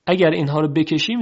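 normal talking voice

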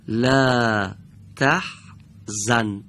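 slow drawn recitation. Shown as a demonstration. Teacher modeling for students, slow recitation. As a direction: stretch the word تحزن slowly, recitation style. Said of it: "teacher models Quran word drawn out".

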